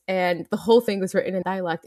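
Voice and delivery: normal talking voice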